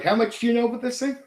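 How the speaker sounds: hasty taunting quiz; mock question. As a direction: rattle off the smug question quickly. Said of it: "It's like".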